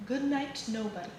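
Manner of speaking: ordinary delivery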